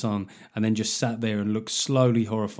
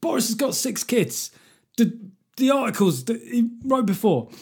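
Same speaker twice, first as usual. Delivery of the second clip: mock panic; 7 semitones higher than usual. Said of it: "like the Joker when you're on the left".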